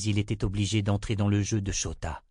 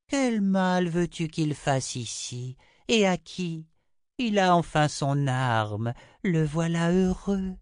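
high, slow, drawn-out seer voice; Chota, dismissive; 7 semitones higher, slower than usual. A narrator's reading. Chota waves away Richard's fears about Samuel, high languid dismissal. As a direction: stretch the words loftily, high-pitched.

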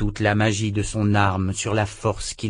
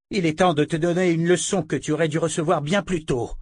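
rugissement; 7 semitones higher, faster than usual. A narrator's reading. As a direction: menace furieuse, voix plus aiguë. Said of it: "rugit Verna". (Verna rugit en avançant vers Richard)

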